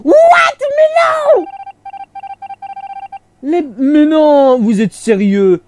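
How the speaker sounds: real frustrated shout; outraged shout. Real, not acted. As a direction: yell at the screen in protest. Teammates vote him out.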